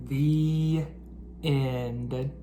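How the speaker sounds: lively bedtime storybook reading; reading to children. A narrator's reading. Teacher reading a storybook aloud to the class. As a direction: read it like a picture book to kids.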